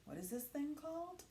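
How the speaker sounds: ordinary talk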